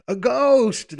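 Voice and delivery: high, loud frightened outcry; cry of terror. A narrator's reading. Disciples see a figure on the water, terrified cry. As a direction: cry it out in sudden alarm. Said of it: "crying out in terror".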